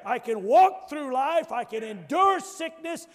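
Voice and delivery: loud, rising preacher's shout, slow and heavy; full force preaching. Acted. Preacher shouting to the congregation he fears no death. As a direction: proclaim it like a triumphant cry.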